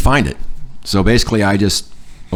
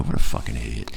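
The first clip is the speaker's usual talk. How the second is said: low, soft retold aside; muttered aside; 8 semitones lower, 7 dB quieter than usual. Turning his back on her, muttered aside. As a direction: drop it under your breath, lower.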